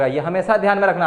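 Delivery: normal talking voice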